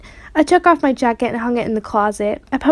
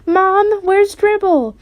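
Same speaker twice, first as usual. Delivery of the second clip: hollered; 9 semitones higher, much slower than usual. A narrator's reading. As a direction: call out to mom in alarm.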